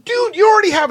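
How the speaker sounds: high, loud mock argument; imitated protest. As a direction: argue back in an exaggerated high voice. Acted argument between bandmates.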